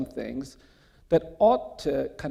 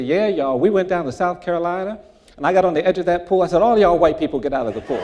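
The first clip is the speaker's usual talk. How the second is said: loud, fast, higher showing-off retelling, laughing at the end; sister's embellished boast; 5 semitones higher, 12 dB louder, faster than usual. Retold or imitated. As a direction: ham up the sister bragging to friends. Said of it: "she would really embellish it".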